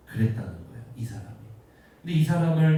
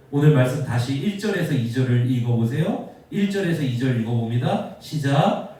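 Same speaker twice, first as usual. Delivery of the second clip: usual speaking voice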